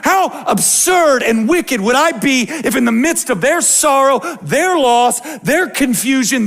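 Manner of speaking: high, loud preacher's cry; loud, high rising sermon peak; fervent preaching. Acted. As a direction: drive the point home from the pulpit. Pastor preaching at full force.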